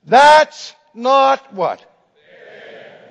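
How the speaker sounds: loud, slow protesting shout; the whole class crying out together; outcry in protest. Retold or imitated. Students protesting the failing grade, loud slow outcry. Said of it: "they cried out in protest".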